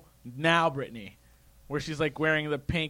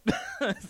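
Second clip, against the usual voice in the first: high squealing burst of laughter; sharp high giggle; 5 dB quieter than usual. Real, not acted. Laughing at own joke.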